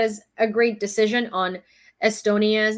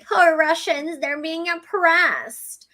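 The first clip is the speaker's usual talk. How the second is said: higher, drawn-out sarcastic whine; mocking whine; 6 semitones higher, much slower than usual. Mocking Russians who complain of oppression, whiny sarcastic voice. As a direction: mimic a self-pitying whiner, very slowly. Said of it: "You're just willing to whine and complain".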